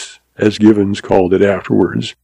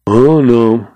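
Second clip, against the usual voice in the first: plain reading voice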